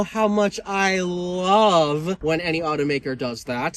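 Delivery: drawn-out, sarcastic "love". Real, not acted. Car reviewer mocking automakers' combined taillights, sarcastic. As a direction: feign adoration, stretching LOVE with heavy irony. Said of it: "That was sarcasm".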